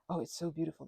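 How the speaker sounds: usual speaking voice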